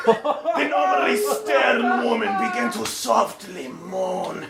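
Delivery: usual speaking voice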